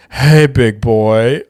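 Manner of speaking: slow, drawn-out seductive purr; flirty voice note. Retold or imitated. Joking voice note to his mates, a slow hammed-up flirt. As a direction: coo it slowly like a come-on. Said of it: "the most fucking homo voice recording".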